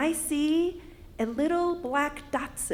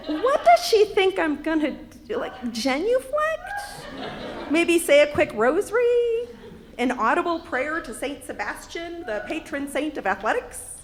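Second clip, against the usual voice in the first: higher, incredulous rhetorical questions; comically baffled disbelief; mock incredulity; 3 semitones higher than usual. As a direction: pile up absurd guesses, each lifting at the end.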